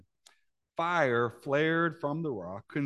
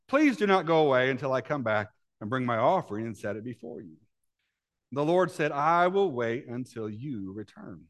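scripture reading; 6 semitones higher, 6 dB louder than usual. Acted. Reads scripture in raised reading voice.

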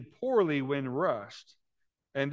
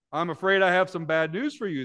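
smug and louder, savouring the bad news; gloating; 4 semitones higher, 6 dB louder than usual. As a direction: gloat over the student, pleased with yourself. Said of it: "the professor gloated".

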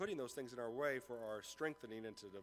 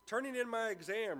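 high, louder, self-assured reply; confident retort; 8 semitones higher, 6 dB louder than usual. A narrator's reading. Student answering the professor, confidently. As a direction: toss it back with confidence. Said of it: "retorted the student confidently".

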